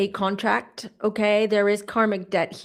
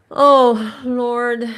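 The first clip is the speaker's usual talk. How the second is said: emotional; 4 semitones higher, 7 dB louder than usual. Real, not acted. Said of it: "I'm already getting emotional".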